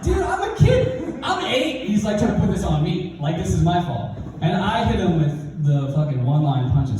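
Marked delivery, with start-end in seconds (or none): 0.0-1.9 put-on high, exclaimed little-kid protest; hammed-up kid defending himself, pitched way up; protesting child. Eight-year-old refusing the stepdad's blame, protesting.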